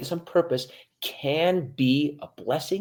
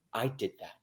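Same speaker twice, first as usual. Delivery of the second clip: quiet self-affirmation; 8 dB quieter than usual. Shown as a demonstration. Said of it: "is to say".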